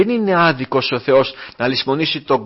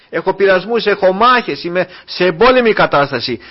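a raised, rhythmic list of trials; fervent preaching; 4 semitones higher, 4 dB louder than usual. Acted. Pastor reminding the brothers of their struggles, fervent preaching. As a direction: pile up the hardships like a preacher.

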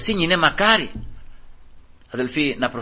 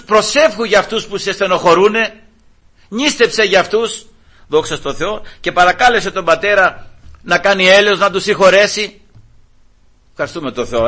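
raised, ringing pulpit voice; fervent sermon delivery; full-force preaching; 5 semitones higher, 5 dB louder than usual. Acted. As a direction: preach it out to the whole church. Preaching to the congregation.

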